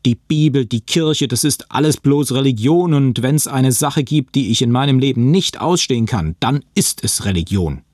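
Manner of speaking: laut und höher, aufgebracht; wütende Tirade. Acted. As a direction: steigere dich in Wut gegen Religion. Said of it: "Ich randalierte weiter". (Josh wettert wütend gegen Religion)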